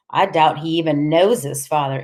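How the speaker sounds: plain reading voice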